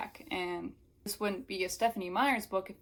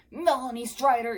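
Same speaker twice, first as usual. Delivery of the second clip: loud, higher acted shout; growled near-shout; 5 semitones higher, 9 dB louder than usual. Jared calling Melanie back in her ear, growling near-shout. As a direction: growl the name, almost shouting. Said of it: "a growl that was almost a shout".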